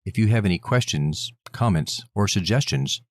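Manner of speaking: low, measured sign-off; podcast outro read. Acted. Host thanks listeners for their time, low outro read. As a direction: read the contact details like an announcer.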